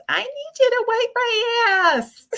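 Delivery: put-on high little boy calling out; little kid voice. Mom imitates her little son calling for help, high and childlike. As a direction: squeak it like a small child. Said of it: "hear his little voice".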